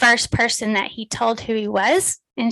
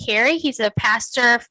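ordinary talk, nothing marked

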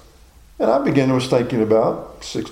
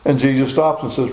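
usual speaking voice

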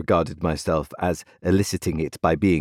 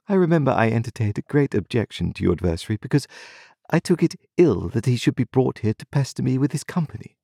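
quick, lightly higher proud voice; haughty young lady; 6 semitones higher, faster than usual. A narrator's reading. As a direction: brush it off like a proud girl. Estella voiced in reading.

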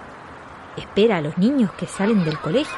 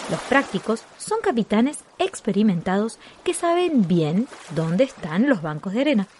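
ordinary talk, nothing marked

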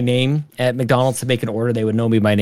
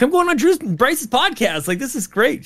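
much higher, eager gushing; excited bragging; 11 semitones higher than usual. Retold or imitated. Guest retells bragging to his wife, high and eager. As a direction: gush like an excited kid. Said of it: "I'm like".

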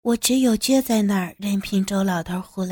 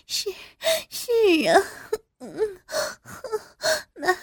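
much higher, breathless moaning voice; 12 semitones higher than usual. A narrator's reading. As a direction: moan the words out high and broken. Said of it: "浪吟吟的道".

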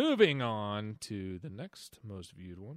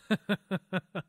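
burst of laughter; 9 semitones higher, 7 dB louder than usual. Real, not acted. Laughing at comment.

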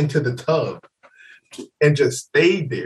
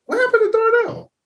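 much higher, fast, squeaky quote; high mock friend; faster than usual. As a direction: toss it off high and quick like a confused buddy. Friends asking where he went.

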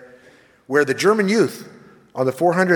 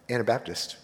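ordinary talk, nothing marked

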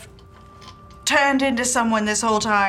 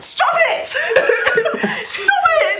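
high, loud, giggling stop it; laughing protest; 5 dB louder, much slower than usual. Acted. Friend begs the copycat to stop, laughing squeal. Character reacts to uncanny voice.

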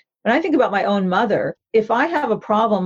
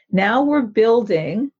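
ordinary delivery

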